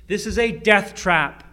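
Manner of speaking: normal talking voice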